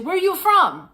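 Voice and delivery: high, loud vendor's broken English; pushy vendor in broken English; mock broken-English vendor. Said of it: "she keeps saying".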